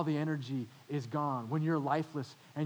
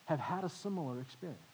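ordinary talk

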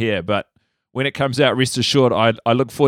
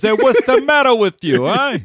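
put-on high, loud Kiwi take on a New York Italian accent; hammed-up New York Italian tough guy, slow on the last line; 9 semitones higher, 4 dB louder, slower than usual. Dentist scolding the germs in a New York Italian accent. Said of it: "Kiwi version of a New York Italian accent".

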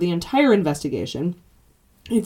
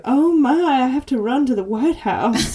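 fussy Southern belle voice; 6 semitones higher than usual. Retold or imitated. Mocking a fussy politician.

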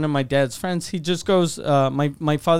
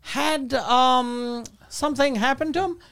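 imitating dad's old friend; 6 semitones higher, slower than usual. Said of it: "And he goes".

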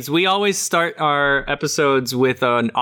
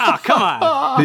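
laughing protest; 9 semitones higher than usual. Real, not acted. Protesting teasing about age.

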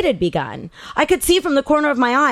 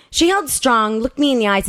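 usual speaking voice

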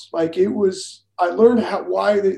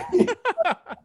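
laughing; 14 semitones higher than usual. Real, not acted. Telling the Yankees story, a high burst of laughter. Laughs at his own punchline.

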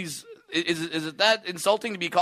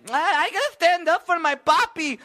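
high, loud, hammed-up Mexican wife; mock the wife in a thick Mexican accent; 12 semitones higher, 4 dB louder than usual. Heckler's wife defending her man, high mock Mexican accent. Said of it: "the wife's like".

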